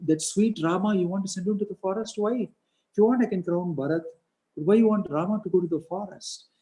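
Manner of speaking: high-pitched, incredulous pleading; insistent questioning. Retold or imitated. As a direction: keep pressing for a reason. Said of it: "Then he kept asking".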